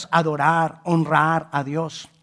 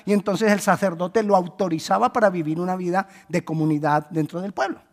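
usual speaking voice

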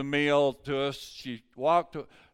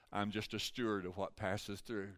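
ordinary talk

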